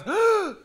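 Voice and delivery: sharp high gasp of a shocked crowd. Retold or imitated. Preacher acts out the wedding crowd's shock, high gasp. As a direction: suck in air like the whole crowd. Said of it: "And the entire crowd goes".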